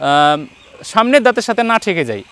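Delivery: usual speaking voice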